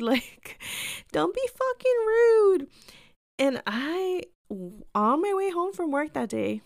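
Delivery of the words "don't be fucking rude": high, loud, drawn-out protest; mock indignant cry. Teller protesting a customer's rude remark, hammed-up indignation. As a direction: wail it indignantly, way up high, hamming it up.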